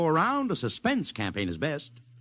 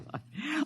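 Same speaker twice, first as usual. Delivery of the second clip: short laugh; 12 semitones higher, 9 dB quieter than usual. Acted. Laughs at the Martian claim.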